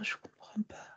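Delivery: faint whispered despair. Real, not acted. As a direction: fade into a helpless hush. Child trails off, lost.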